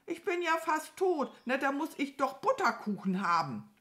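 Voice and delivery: high, insistent bird whine; high-pitched pitiful demand; self-pitying crow. A narrator's reading. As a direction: plead as a crow hamming up its injury. Crow milks its injury.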